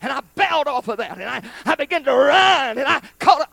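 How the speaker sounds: loud, high burst of storytelling; excited shouting. Retold or imitated. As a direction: relive the leap at full voice. Relives leaping off to chase him.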